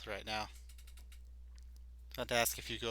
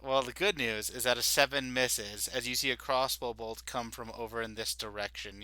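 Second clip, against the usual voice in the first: usual speaking voice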